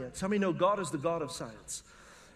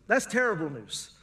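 ordinary delivery

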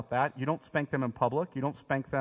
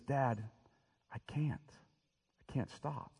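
soft, fading, a hint of a crushed boy; defeated son; 9 dB quieter, slower than usual. Son admits to his father he can't stop, quietly. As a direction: let it sink to almost nothing, helpless. Son's broken confession to father.

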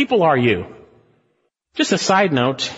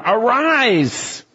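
raised, drawn-out "Arise"; high wake-up call; captain's wake-up call; 8 semitones higher than usual. Acted. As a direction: rouse the sleeper. Captain wakes Jonah.

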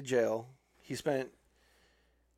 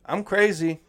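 higher, louder mock-crazy claim; a hammed-up "I'm crazy"; mock crazy plea; 6 semitones higher, 7 dB louder than usual. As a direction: play it like a cheeky fake madman. Mock insanity plea quote.

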